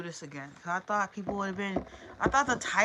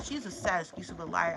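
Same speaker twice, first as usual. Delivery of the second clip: usual speaking voice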